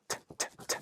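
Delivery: whispered "t, t, t" as crisp voiceless taps; unvoiced "t, t, t" tongue clicks. Shown as a demonstration. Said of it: "I'm saying t".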